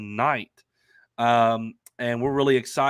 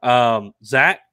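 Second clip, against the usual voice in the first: ordinary delivery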